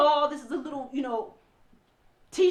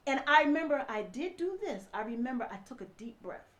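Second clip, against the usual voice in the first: normal talking voice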